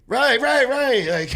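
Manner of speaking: excited. Real, not acted. Excited agreement, laughing.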